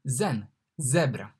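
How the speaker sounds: zen to zebra, slow; comparison demo. Shown as a demonstration. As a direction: link zen to zebra slowly. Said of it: "like Z in zen".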